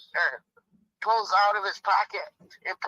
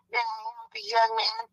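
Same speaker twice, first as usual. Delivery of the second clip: lady clerk voice; faster than usual. Acted. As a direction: play the clerk prim and high-pitched. Lady clerk third time.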